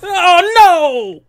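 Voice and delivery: high, loud frightened shriek; afraid shriek. Real, not acted. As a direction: cry out in real fright. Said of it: "I'm very afraid right now".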